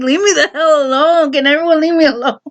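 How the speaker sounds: high-pitched fed-up plea, half laughing; retold pestered kid's complaint; mock exasperation. Child fed up with everyone's questions, mock exasperation.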